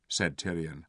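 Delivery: plain reading voice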